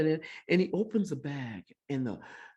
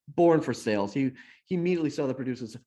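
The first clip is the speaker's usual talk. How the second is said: ordinary talk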